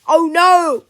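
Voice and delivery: loud, high cry of horror; horrified gasp. A narrator's reading. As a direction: cry out aghast. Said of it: "gasped Mister Nurden".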